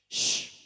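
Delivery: "shh" desis SY keras; bunyi SH. Shown as a demonstration. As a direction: desiskan "shh" sebagai contoh huruf. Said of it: "memang memiliki bunyi". ("shh", bunyi SY yang ditahan)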